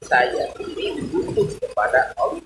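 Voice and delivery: high, breathless whispered prayer; humble whispered confession; whispered plea. Shown as a demonstration. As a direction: plead in a hushed, pleading whisper. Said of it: "hati kita mengikrarkan".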